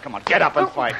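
squaring up to fight; fight taunts. Acted. Hero taunting a man in a fistfight.

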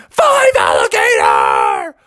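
long, strained cartoon holler; drawn-out acted yell; shouted. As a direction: shout it out slowly, stretching each word. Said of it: "when Bubby shouted".

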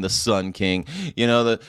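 normal talking voice

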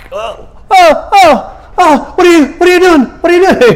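hammed-up high, loud panic; freaking out. Blind man as mud is packed on him, high panicked yelling. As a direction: yelp and squeal in mock alarm. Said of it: "freaking out".

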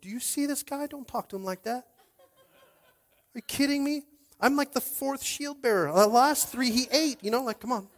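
high-pitched comic sidekick voice; panicky shield bearer. Pretend. Giant's fourth shield bearer begging David to stop, high comic panic. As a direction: plead in a high, incredulous voice. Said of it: "The shield bearer is probably going".

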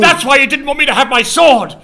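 high, loud accusation; outraged realisation. Acted. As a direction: shout the betrayal out in indignation. Pieces together the betrayal.

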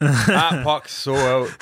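slow, drawn-out exaggerated Canadian; hammed-up Canadian hockey player; super Canadian accent. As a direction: stretch it out in a thick Canadian accent. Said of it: "I turned super Canadian".

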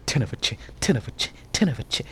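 very low, hushed repetition; barely voiced muttering; muttered aside. Real, not acted. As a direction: mumble it under your breath, over and over. Trails into repeated muttered name.

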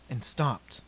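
usual speaking voice

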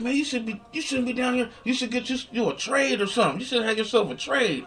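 high lecturing street voice; crackhead voice. Retold or imitated. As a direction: scold like an old street guy. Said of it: "He said".

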